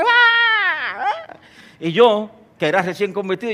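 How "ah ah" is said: mock scream. Preacher imitating the possessed woman screaming, mock scream. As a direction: scream it high but hold the volume. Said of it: "Y comenzó a gritar".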